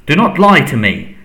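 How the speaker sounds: cold master's sharp rebuke; hissed. A narrator's reading. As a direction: cut off the lie with venom. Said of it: "hissed the second voice".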